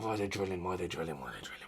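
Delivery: fading disbelief. Real, not acted. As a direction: trail off in horrified disbelief.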